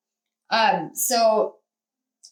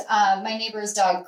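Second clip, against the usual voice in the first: ordinary talk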